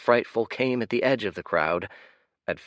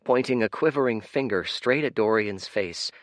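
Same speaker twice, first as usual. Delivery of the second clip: plain reading voice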